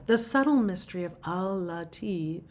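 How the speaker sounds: ordinary talk, nothing marked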